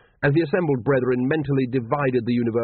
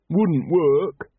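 dourly; 6 semitones higher, 4 dB louder than usual. A narrator's reading. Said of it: "said Brother Watchtower dourly".

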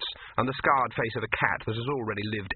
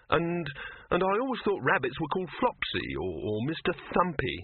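higher, stumbling babble; nervous gabble; 7 semitones higher than usual. A narrator's reading. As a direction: blurt it with nervous restarts. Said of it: "Victor gabbled".

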